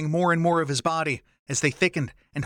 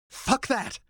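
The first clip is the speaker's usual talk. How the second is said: much higher, defiant snarl; 10 semitones higher than usual. A narrator's reading. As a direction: spit it back in defiance. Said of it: "Len snarled".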